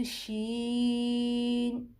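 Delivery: شین stretched long and slow; drawn-out letter. Shown as a demonstration. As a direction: hold the vowel in شین. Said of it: "ہم یہ نہیں کہیں گے شین".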